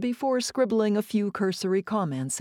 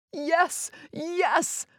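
much higher, joyous cheering; jubilant; 10 semitones higher than usual. Acted. Chef cheering after his pastry is praised, jubilant. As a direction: celebrate like you just won. Chef celebrating, does a jig.

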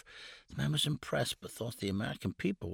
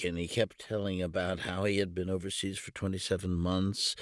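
usual speaking voice

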